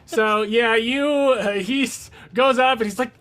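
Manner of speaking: ordinary delivery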